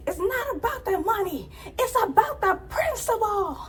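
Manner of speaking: high mock yell; imitating her shouting about principle; high, strained mock outburst. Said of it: "You yelled at".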